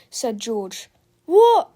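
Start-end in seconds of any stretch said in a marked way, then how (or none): 1.2-1.7 high, loud startled question. A narrator's reading. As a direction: blurt it out alarmed. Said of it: "asked Harold".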